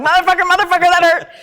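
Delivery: high, loud laughing curse; mock pained yell. Joking to partner about cursing in pain, mock pained yell. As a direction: yell the curse like it really stung. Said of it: "It probably sounded more like".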